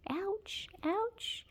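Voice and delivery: high yelps of ouch; squeaky little ouches. Real, not acted. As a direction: yelp it up high as claws dig in. Cat scratching her.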